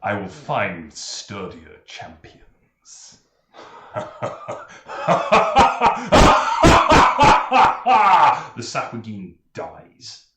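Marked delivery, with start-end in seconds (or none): 0.0-3.2 low, menacing priestess voice; sea priestess voice. Acted. Priestess's final words. 3.6-8.5 loud villainous laugh; evil laugh. Acted. Villain's laugh after threat.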